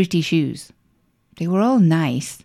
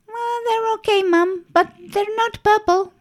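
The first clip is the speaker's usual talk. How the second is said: put-on high, hesitant child voice; unimpressed little girl, hesitating.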